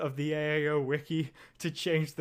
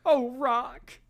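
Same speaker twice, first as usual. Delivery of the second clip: pleading manager; 7 semitones higher than usual. Acted.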